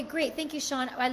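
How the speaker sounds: ordinary talk, nothing marked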